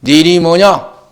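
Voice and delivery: booming voice of the angel demanding a name; loud demanding question. Acted. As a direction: call out the question forcefully. Angel asks Jacob's name. (천사의 우렁찬 질문)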